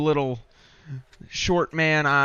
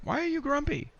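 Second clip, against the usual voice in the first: much higher character voice; NPC voice; 13 semitones higher, 6 dB quieter than usual. Acted. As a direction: ask it in a high NPC voice.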